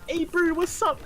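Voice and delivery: high, lightly mimicked Kiwi greeting; New Zealand accent. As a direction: imitate a chirpy New Zealand mate.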